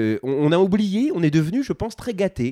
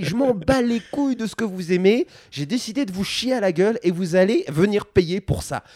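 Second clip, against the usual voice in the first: mock producer; 7 semitones higher than usual. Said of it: "un producteur qui dit".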